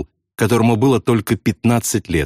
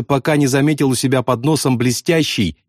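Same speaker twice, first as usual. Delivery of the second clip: ordinary delivery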